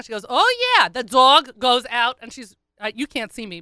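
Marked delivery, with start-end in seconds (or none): 0.3-2.2 emphatic dog owner. Retold or imitated. Woman explaining the dog's outings to a stranger, emphatic insistence. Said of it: "I am very much gesticulating".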